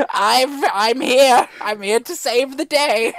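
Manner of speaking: high, loud triumphant announcement; heroic cry. Acted. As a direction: burst in loudly to save the day. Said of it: "He's like".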